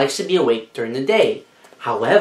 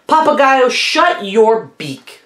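higher, louder angry shout; angry scream; 7 semitones higher, 3 dB louder, slower than usual. Acted. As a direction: snap it out crossly. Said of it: "get angry and scream".

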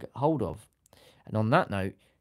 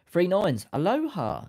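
excited shout; 9 semitones higher, 6 dB louder, slower than usual. Real, not acted. Greets viewer with aloha.

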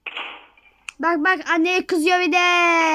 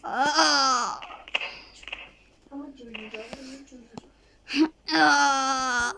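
mock crying wail; 3 semitones lower, 8 dB quieter than usual. Character burning in game.

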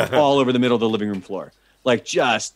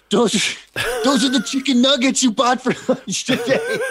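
high, giggling through the words; laughing; 13 semitones higher than usual. Retold or imitated. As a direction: laugh while you explain. Buddy cracking up about nuggets.

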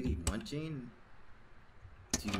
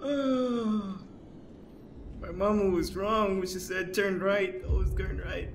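high, loud distressed wail; scared; 8 semitones higher, 7 dB louder, slower than usual. Real, not acted. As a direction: complain loudly in a panicked high voice. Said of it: "I'm so scared".

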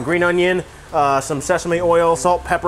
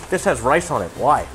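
ordinary talk

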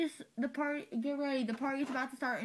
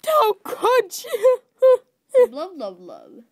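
mock wail; 7 semitones higher, 15 dB louder, slower than usual. Mock heartbroken outburst.

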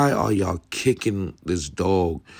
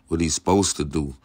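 normal talking voice

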